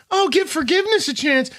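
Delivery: very high, pleading mock voice; mock forgiving fan. Mimicking the team that wants forgiveness, mock plea. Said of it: "You're on the team of".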